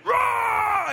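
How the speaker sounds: high, drawn-out roar; roaring charge. Retold or imitated. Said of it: "come running out with noise".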